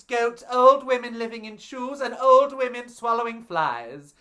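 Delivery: lightly voiced gushing Goldilocks; a hint of a theatrical Goldilocks praising her friend; Goldilocks in character. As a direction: keep the fond, showy Goldilocks going. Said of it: "I will be in character".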